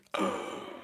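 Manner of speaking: sharp, breathy gasp of dismay; mock-shocked gasp. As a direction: gasp as if at dreadful news. Reacts to shattered perfume bottle.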